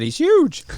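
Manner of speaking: high, punched stress on HUGE; emphatic insistence. Retold or imitated. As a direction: exclaim it, voice leaping high on HUGE. Insisting friend is big, retold.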